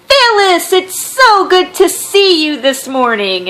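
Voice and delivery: impersonating his mother greeting Phyllis, high and slow; sugary mom greeting. Retold or imitated. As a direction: coo the name like an overly sweet hostess. Imitating Ed's mom's greeting.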